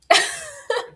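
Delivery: squealing high laugh. Real, not acted. Laughing at her own story, high squeal. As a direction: burst into a high giggle.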